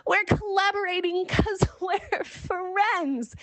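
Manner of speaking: high, slow, laughing giddy delight. Retold or imitated. Answering Carmen's message, high slow laughing delight. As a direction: savour it, laughing with joy. Retelling own happy reaction.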